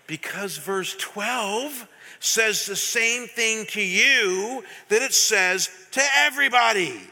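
building to a loud, slow, emphatic preach; near yelling. Real, not acted. Pastor to his congregation, passionate near-yell. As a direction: hold back a yell and lose. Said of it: "I'm not gonna yell".

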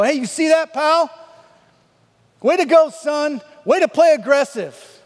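loud, high retold shout; triumphant mocking sideline holler; gloating taunt. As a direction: throw it back at a rival parent. Retold taunt back at rival father.

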